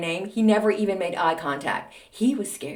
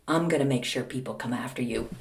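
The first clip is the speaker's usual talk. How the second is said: lower, softer mock menace; low threat; 7 semitones lower, 5 dB quieter than usual. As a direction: imitate mom's quiet threat. Mother's retold threat.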